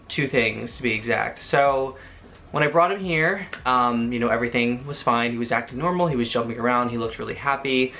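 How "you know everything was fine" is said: usual speaking voice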